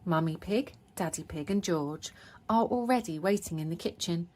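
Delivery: ordinary delivery, nothing marked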